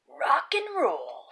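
breathy whispered aside. A narrator's reading. Storyteller whispers a cool aside to the kids, whispered. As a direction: hush it like a cool secret.

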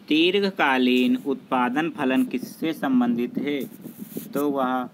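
ordinary delivery

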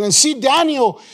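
ordinary delivery

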